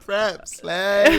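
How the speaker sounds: high, loud mock diva call; hammed-up slay. Friends riffing on prep and slay, hammed-up diva. Playing up the mimicked slay bit.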